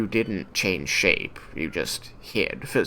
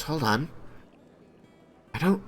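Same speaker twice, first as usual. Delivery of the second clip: dog pausing; 8 semitones higher than usual. Acted. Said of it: "sniffs the ground and goes".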